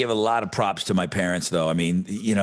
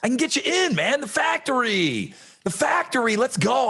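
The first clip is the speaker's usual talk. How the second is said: hammed-up whiny urging, pitched way up; pleading persuader; 10 semitones higher than usual. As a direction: coax hard, stretching the key words. Voices someone urging a factory job.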